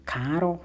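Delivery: "Carl" stretched into two syllables, CAH-rol; "Carl" with an extra vowel before the l; imitated accent. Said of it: "she used to call the main guy".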